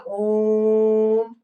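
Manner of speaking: ओम sustained very slowly on one note; long drawn-out ओम. Shown as a demonstration. Teacher showing how long it lasts, ओम drawn out. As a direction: hold ओम long and unwavering. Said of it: "इस तरह से देर तक होता है".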